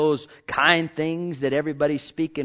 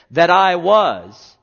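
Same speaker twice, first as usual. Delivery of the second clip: emphatic; 4 semitones higher, 10 dB louder, much slower than usual. Acted. Preacher stressing his old self.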